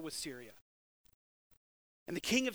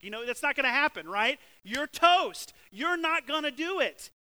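higher, louder, fast; emphatic; 7 semitones higher, 10 dB louder than usual. Real, not acted. Preacher to the congregation, insisting they're toast, loud. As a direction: hammer the point home, almost shouting. Preacher mocking false confidence.

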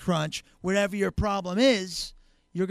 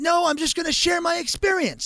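stubborn addict; 9 semitones higher, 4 dB louder than usual. Acted. Addict refusing treatment, acted quote.

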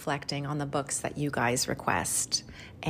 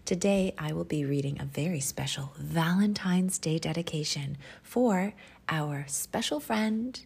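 ordinary delivery

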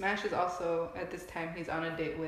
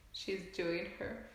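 ordinary delivery

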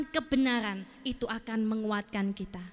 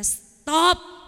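sudden loud, higher order; firm command; 4 semitones higher, 6 dB louder than usual. Retold or imitated. Preacher orders his own wandering soul, loud firm command.

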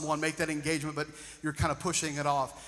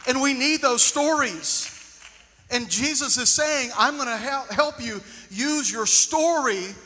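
loud, slow, higher preaching at full force; fervent preaching; 7 semitones higher, 6 dB louder, slower than usual. Acted. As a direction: proclaim it slowly to the whole room. Preaching opener to congregation.

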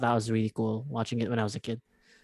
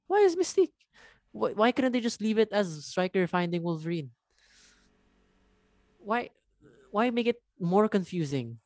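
higher-pitched, incredulous complaint; recounted bewilderment, pitched up; exasperated disbelief; 7 semitones higher than usual. Retold or imitated. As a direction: protest in a high, baffled voice. Retelling own exasperated reaction to film.

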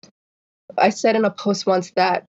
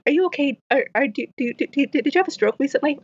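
higher-pitched mock concern; mimicked nosy questioner; 7 semitones higher, faster than usual. Stutterer quoting people's questions to her, higher mimicked concern. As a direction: imitate a prying question, pitched up.

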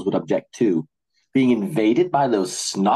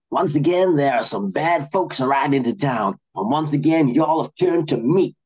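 fake cowboy drawl; 5 semitones higher than usual. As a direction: play the Western sheriff rallying the town. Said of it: "his fake cowboy drawl".

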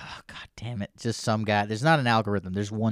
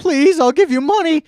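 high, loud pleading whine; begging voice; 8 dB louder than usual. Retold or imitated. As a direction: beg desperately. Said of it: "if you're just like".

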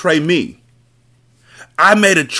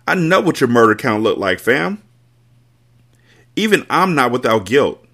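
shaking with anger; 9 semitones higher than usual. Acted. Carter confesses, shaking with anger.

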